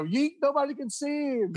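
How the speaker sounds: hammed-up mother giving it to him; high fussing mom voice; mom scolding. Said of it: "my mom was giving it to him".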